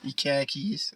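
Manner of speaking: "khakis" as Boston "car keys" pun, R dropped; mock Boston "khakis" with a rise; joking Boston accent. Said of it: "the Boston accent".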